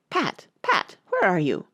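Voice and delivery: sharp calling out for Pat; angry call. A narrator's reading. Rabbit angrily calls for his servant, angry call. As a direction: demand it crossly. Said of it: "Next came an angry voice".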